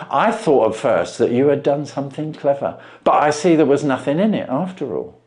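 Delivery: a plain man dumbfounded by the trick; Wilson voiced.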